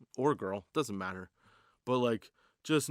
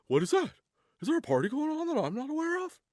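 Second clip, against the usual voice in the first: high put-on dog voice, puzzled; dog's voice; 4 dB louder, faster than usual. The dog wondering about a party outside, high put-on voice. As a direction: voice the curious dog.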